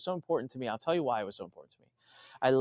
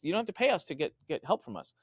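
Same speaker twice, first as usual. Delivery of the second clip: normal talking voice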